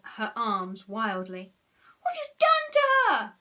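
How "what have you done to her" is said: very high, loud wail; shrill distressed cry; horrified wail. A narrator's reading. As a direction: wail in alarm for a friend. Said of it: "wailed sour Susan".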